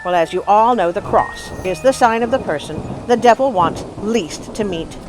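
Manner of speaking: usual speaking voice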